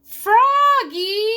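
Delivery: loud high call across the bus; yelling Froggy. A narrator's reading. Friend Max shouting across the bus. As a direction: Max yells his friend's name. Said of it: "yelled his friend Max".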